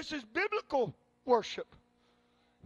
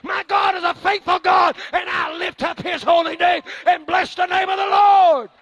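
preaching building to full force; 4 semitones higher, 10 dB louder than usual. Acted. Preacher crying out his praise to the congregation. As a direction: build to a roaring climax.

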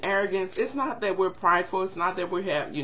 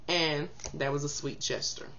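ordinary delivery

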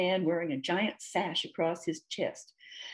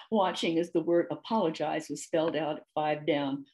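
ordinary delivery

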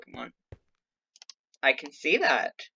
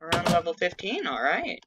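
normal talking voice

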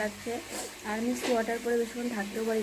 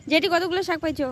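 higher, louder call across the field; 7 semitones higher, 11 dB louder than usual. Real, not acted. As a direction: call out loudly to someone far off. Calling out to aunt outdoors.

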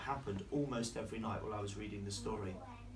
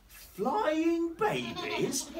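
very high, loud, stretched question; hammed-up astonishment, soaring in pitch; incredulous echo; 7 dB louder, much slower than usual. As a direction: squeal it slowly in mock disbelief. Daddy incredulously echoes Lulu's claim.

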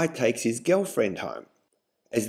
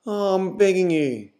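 high, whining plea; begging; 6 semitones higher than usual. A narrator's reading. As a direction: beg her shamelessly. Guy begging.

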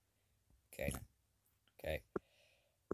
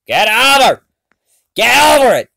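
yelling; 7 semitones higher, 4 dB louder than usual. Real, not acted. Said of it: "You're making me yell".

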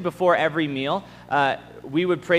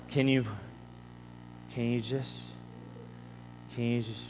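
grumpy and sleepy; 6 semitones lower, 7 dB quieter than usual. Retold or imitated. Tired teen praying at dawn, groggy and fading. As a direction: drift off mid-sentence, groggy. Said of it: "I was so grumpy".

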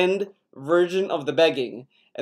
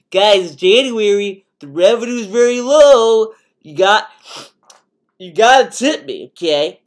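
loud whiny streamer rant, higher; ranting streamer impression; 4 semitones higher, 6 dB louder than usual. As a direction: play a streamer begging for tips and ranting. Mocking streamer begging for tips.

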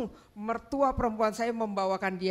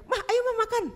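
much higher, coaxing call; husband calling; 13 semitones higher, 6 dB louder than usual. Retold or imitated. Husband calls his wife to come eat, much higher. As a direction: coax her to come eat.

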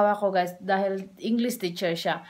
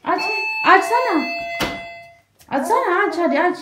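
high, loud eager urging; loud playful urging to the baby; excited shouting; 12 semitones higher, 6 dB louder, much faster than usual. Acted. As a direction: cheer her on to open it.

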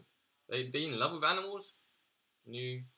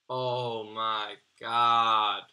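drawn-out groan; 2 semitones lower, 5 dB louder, much slower than usual. Real, not acted. Despair after bad pull.

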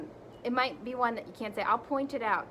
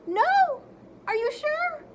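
high, loud outraged squeal; shocked; 9 dB louder, slower than usual. Acted. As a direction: protest like a kid. Reader protests more pages.

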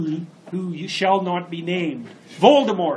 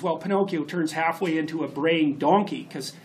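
normal talking voice

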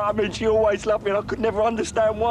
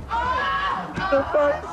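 laughing; 12 semitones higher than usual. Acted. Breaking down over his mother.

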